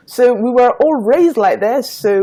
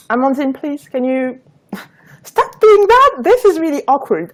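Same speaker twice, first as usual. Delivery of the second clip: higher-pitched retold plea; pleading sister; 6 semitones higher than usual. Sister telling friend to stop the accent, retold pleading. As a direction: imitate a sister urging someone to stop. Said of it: "my sister told her".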